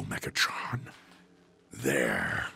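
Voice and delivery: hushed unvoiced whisper of a name. Searching the defense grids for Megatron, whispered. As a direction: breathe the name, stalking.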